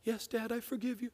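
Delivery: put-on high, soft child voice; young boy. Son forgiving dad after being yelled at, soft high voice. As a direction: imitate a small son forgiving meekly.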